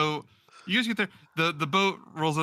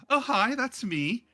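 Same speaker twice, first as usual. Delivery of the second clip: higher, slower bright hello; Nessa's eager voice; 8 semitones higher, slower than usual. Acted. Game master voicing Nessa answering Lorius, higher and slower. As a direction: pitch her up and slow the reply.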